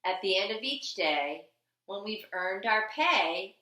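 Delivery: ordinary delivery, nothing marked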